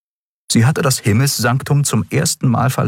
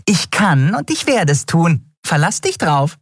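much higher, gloating voice with a grin; nasty grin; 10 semitones higher than usual. A narrator's reading. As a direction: savour his misery. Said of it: "Nelen grinste fies".

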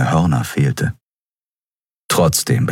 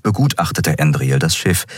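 usual speaking voice

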